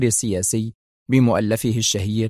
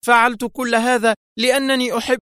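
much higher, impassioned outburst; angry cry; 11 semitones higher than usual. A narrator's reading. Woman nearly confesses love to the agent, angry high-pitched cry. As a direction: blurt it angrily in a high voice, cutting off. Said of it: "صاحت في غضب".

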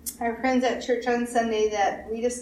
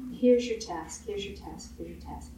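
fading to a whisper; 3 semitones lower, 6 dB quieter than usual. Acted.

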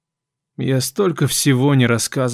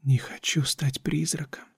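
unvoiced whisper, much softer; whispering a secret; 3 semitones higher, 10 dB quieter than usual. A narrator's reading. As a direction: whisper it right into his ear. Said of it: "приблизила губы к моему уху".

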